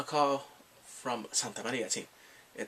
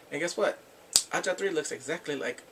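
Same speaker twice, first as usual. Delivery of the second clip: ordinary talk, nothing marked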